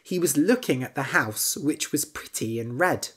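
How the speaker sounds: plain reading voice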